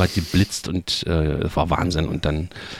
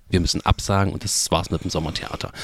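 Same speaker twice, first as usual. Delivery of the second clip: normal talking voice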